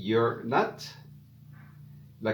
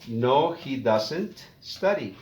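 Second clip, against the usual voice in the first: No, he doesn't study spoken slowly, falling on study; slow model answer; slower than usual. Shown as a demonstration. Teacher modelling the negative answer for learners, slowly.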